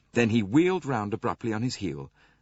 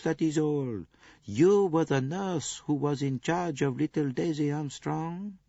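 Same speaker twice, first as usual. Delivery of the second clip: higher, gentle reassuring voice; calm a crying woman; soothing Poirot; 8 semitones higher than usual. Acted. Poirot soothing weeping nurse.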